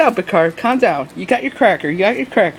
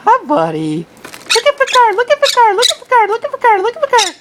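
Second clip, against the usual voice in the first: much higher cooing voice for a pet bird; 13 semitones higher than usual. Real, not acted. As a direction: coo at the parrot in a much higher voice. Baby talk to pet bird.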